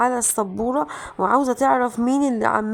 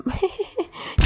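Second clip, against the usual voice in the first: high giggle; 7 semitones higher, 4 dB quieter than usual. Real, not acted. Laughing at prank success.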